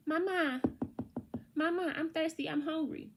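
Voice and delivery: put-on high, nagging little kid calling Mama; whiny child. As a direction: play the child tugging at mom. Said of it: "So say you have children".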